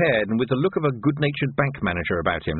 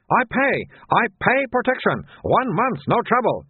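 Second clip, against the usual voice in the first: insistent shopkeeper; 11 semitones higher than usual. Acted. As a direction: keep repeating it, high and firm. Soon insisting.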